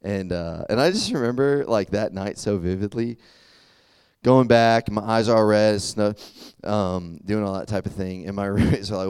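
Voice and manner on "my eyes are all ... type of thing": ordinary delivery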